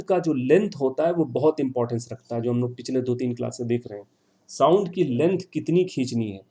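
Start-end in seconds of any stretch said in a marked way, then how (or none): none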